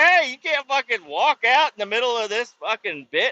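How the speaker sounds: light hammed-up protest; mock protest. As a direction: call after the wife walking away. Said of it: "I'm like".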